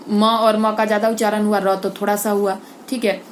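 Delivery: usual speaking voice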